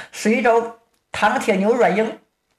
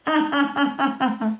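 put-on old man's laugh; 4 semitones higher, 4 dB quieter than usual. Old master laughs coldly at disciple.

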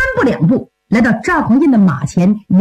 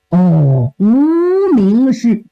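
drawn-out realization; 3 semitones lower, much slower than usual. Acted. The emperor drawls the pun in slow mock dismay. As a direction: stretch it very slowly, half a sob. Zhao realizes the name's meaning.

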